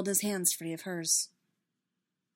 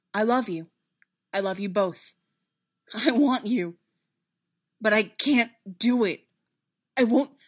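loud, impassioned confession in short bursts; anguished declaration; 3 semitones higher, 10 dB louder than usual. A narrator's reading. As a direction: declare love, then refuse in distress. Anguished love and refusal.

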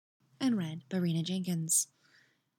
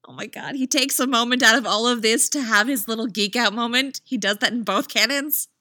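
loud, higher fangirl gush; louder, high-pitched excited aside; 4 semitones higher, 6 dB louder than usual. Real, not acted. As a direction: gush delightedly over the character. Delighted fan aside.

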